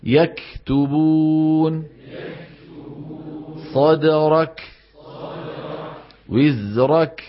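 "يكتبون" slow, a puff after the silent k; model breathed kaf. Shown as a demonstration. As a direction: let air escape on the final k of "صدرك" and "وزرك". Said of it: "والصواب".

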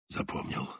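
breathy whispered confirmation. A narrator's reading. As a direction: whisper it close to the ear. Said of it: "Только шёпотом".

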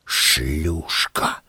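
low, soft hissed insult. A narrator's reading. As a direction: hiss it under your breath. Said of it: "прошипел я".

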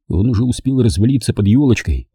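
ordinary delivery

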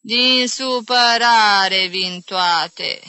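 very slow, loud wail; lamenting. Acted. Aunt blaming all her ills on grief, lamenting. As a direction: draw each word out mournfully. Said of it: "se căină Magdalena".

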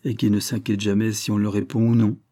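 usual speaking voice

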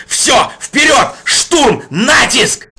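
shouting attack orders; battle cry. Acted. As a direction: roar the charge. Leader orders assault.

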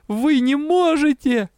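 high-pitched, drawn-out desperate cry; acted anguished cry, slow and high; crying out through sobs. A narrator's reading. Patient protesting to those around her, desperate tearful cry. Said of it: "кричу сквозь рыдание".

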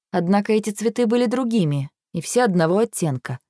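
usual speaking voice

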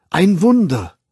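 high, loud declamation of wonder; rapt declaiming. A narrator's reading. Young man quoting Miranda to Bernard, declaiming in rapture. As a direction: proclaim it aloud, enraptured. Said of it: "deklamierte er mit leuchtenden Augen".

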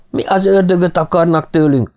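high, protesting cry held up throughout; narrated yelling. A narrator's reading. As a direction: demand it in a restrained high yell. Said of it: "Martin egyre csak ordított". (magas hangú, visszafogott ordítás)

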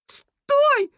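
very high, loud shriek; yelling rat. A narrator's reading. Rat stopping a litterer, shrill yell. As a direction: scream the order out slowly. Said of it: "заорал Крыс".

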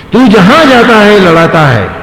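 angry accusation. Retold or imitated. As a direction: act out a sage's indignant accusation. उतंग का क्रोध में आरोप. (गुस्से में आरोप लगाते हुए)

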